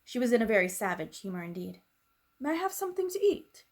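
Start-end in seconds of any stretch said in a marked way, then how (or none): 2.4-3.7 faint request. Acted. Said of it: "Sarah asked rather faintly".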